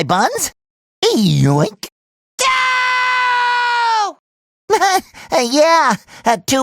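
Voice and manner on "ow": high, long-held cartoon howl; pained cry. Cartoon hero getting hurt, long high howl. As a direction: hold a high yelp of pain.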